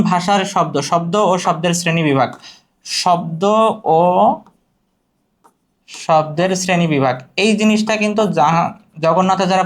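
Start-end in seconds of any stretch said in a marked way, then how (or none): 2.8-7.2 drawn-out opening, pause before the rest; slow dictation. Shown as a demonstration. Topic repeated as dictation. (থেমে থেমে ধীরে বলা)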